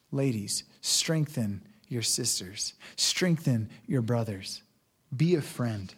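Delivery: quiet, heartfelt sermon close; tender preaching. Real, not acted. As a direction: gently encourage the hurting listener. Tender closing appeal.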